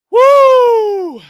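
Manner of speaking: high loud whoop; joyful whoop. Real, not acted. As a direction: whoop at full volume. Celebrating the win.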